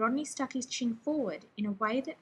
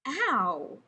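amazed; 4 dB louder than usual. Acted. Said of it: "sounding amazed".

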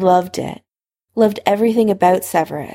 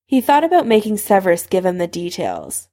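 ordinary delivery, nothing marked

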